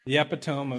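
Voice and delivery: "epitome" said as EP-ih-tome; "epitome" in three syllables, stressed at the front; misreading. Shown as a demonstration. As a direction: say "epitome" as it looks, rhyming the end with "home". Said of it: "it's easy to read it that way".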